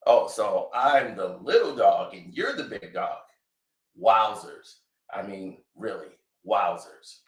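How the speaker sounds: deadpan hurt son; dry sarcasm. Retold or imitated. Drake sarcastically answering his father.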